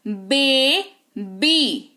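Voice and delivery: बेबी very slowly as "bay... bee"; syllable demo of बेबी, "bay" then "bee"; two separated syllables. Shown as a demonstration. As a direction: split बेबी in two with a gap, each half drawn out. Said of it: "दो बार effort लगाना पड़ता है".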